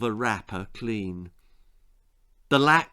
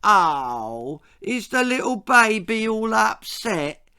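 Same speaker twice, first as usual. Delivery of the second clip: high, loud sing-song mockery; mock him like a crying baby; a bully taunting a crying boy in baby talk; 9 semitones higher, 4 dB louder, slower than usual. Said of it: "he mocked".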